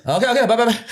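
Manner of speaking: high, hurried goodbye; caller fleeing. Retold or imitated. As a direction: mimic a caller scrambling to hang up. Acted caller hurriedly hanging up.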